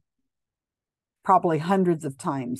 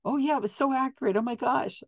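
high, fast, gushing mock delight; mock delighted sitter; 7 semitones higher, faster than usual. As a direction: play the thrilled sitter, quick and high. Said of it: "they say".

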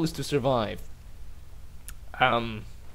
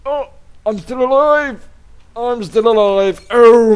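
thrilled yell of relief; excited shout; 6 semitones higher, 9 dB louder than usual. Real, not acted. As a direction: cheer it out loud. Surviving a crash.

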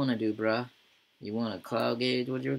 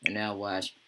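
normal talking voice

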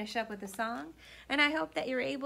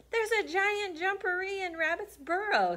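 scared bear; 10 semitones higher, 5 dB louder than usual. Acted.

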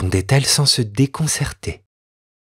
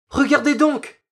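put-on alarmed boy.

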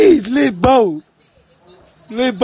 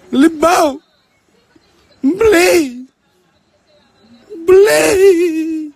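high, loud, tearful begging; mock crying plea; 6 semitones higher, 5 dB louder, much slower than usual. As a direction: wail the name and beg like he's being beaten. Acted beating, begging to be released.

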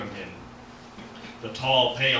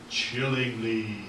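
slow, stretched-out adverbs; drawn-out ominous narration; slower than usual. A narrator's reading. Narrator describing how the man spoke, drawn out ominously. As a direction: draw each word out eerily.

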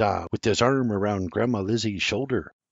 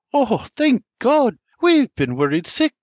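much higher, louder, relieved mother; Ma crying out in relief; 12 semitones higher, 5 dB louder than usual. A narrator's reading. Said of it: "Ma cries".

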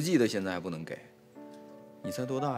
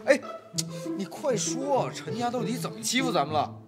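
agitated urging; 9 semitones higher than usual. Acted. He frets while she cries.

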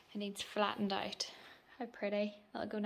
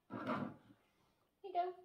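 soft feeding voice; 9 semitones higher, 6 dB quieter, much slower than usual. Real, not acted. Feeding baby.

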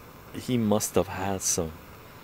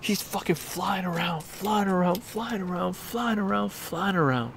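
excited high narration; 8 semitones higher, 3 dB quieter than usual. Retold or imitated. Friend flying around room.

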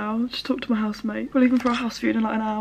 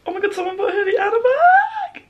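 high, loud squeal rising to a tearful shriek; real excited shriek; 6 dB louder than usual. Real, not acted. Vlogger seeing hoodie and bag sold, excited shriek. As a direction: shriek in overwhelmed disbelief. Big order arrives at launch.

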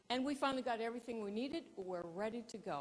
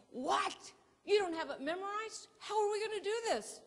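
much higher and louder, speeding up at the end; exasperated disbelief; 12 semitones higher, 6 dB louder than usual. Retold or imitated. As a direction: shriek it like a cameraman in shock. Photographer shocked, no memorized script.

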